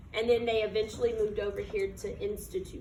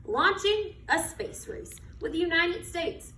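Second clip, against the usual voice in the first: put-on reading to children; 4 semitones higher than usual. Teacher reading a picture book to her class, storytelling voice. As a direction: read it like storytime on the rug.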